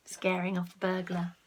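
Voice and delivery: ordinary delivery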